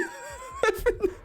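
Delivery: very high soft giggle; high breathless giggle; high cackle. Real, not acted. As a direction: burst into a squeaky laugh. Laughing at the god.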